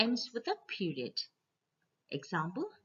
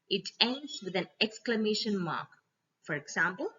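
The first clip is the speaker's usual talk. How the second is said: ordinary talk, nothing marked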